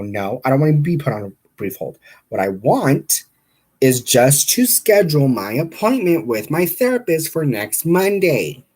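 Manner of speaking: re-enacted insistent complaint, rising in pitch; retold irritation, firmly pressed; retold anger. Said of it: "this is where my anger came in".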